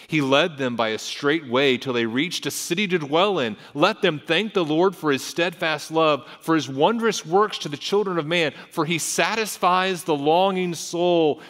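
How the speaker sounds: preacher declaiming with force; impassioned scripture reading. Acted. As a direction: build the psalm into praise.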